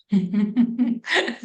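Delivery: amused chuckle; laughing. Real, not acted. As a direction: run of quick chuckles. Laughs about fooling the interviewer.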